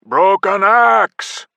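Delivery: slow, loud, high war cry; roar. Acted. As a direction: bellow it like an orc horde. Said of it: "the orcs roared".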